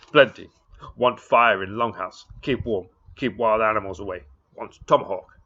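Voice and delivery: loud, blunt demands in broken English; Little Bull demanding. Acted. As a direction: bark out each demand like a proud chief.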